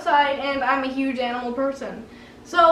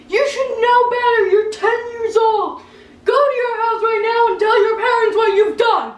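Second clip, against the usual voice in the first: loud, higher, scolding rant; angry neighbor yelling; 6 semitones higher, 4 dB louder than usual. Retold or imitated. Neighbor scolding the girl about his dog, loud yelling. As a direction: rail at the kid about the wet dog. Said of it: "he was loud".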